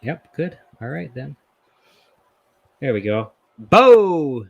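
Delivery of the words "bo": calling out. Real, not acted. Greeting a guest joining the stream.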